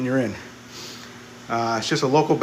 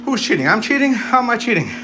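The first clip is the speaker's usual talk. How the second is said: rising higher in disbelief; incredulous; 8 semitones higher than usual. Real, not acted. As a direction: protest the accusation, climbing in pitch. Accused of cheating on lift.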